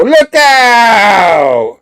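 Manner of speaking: long, loud, high holler; warning yell. Acted. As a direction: stretch it out as a superhero's warning shout. Acted Spider-Man warning cry.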